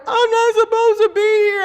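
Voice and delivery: replaying her own sobbing panic, lightly; retold tearful wail. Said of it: "bawling and crying like a nut".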